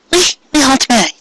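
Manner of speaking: high, fast embarrassed mare voice; flustered Cupcake. Acted. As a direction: hurry to correct her.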